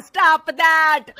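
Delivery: loud, stretched-out mocking challenge; insistent dare. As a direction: STOP THAT shouted slow and drawn out. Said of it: "सास के जोर देने पर".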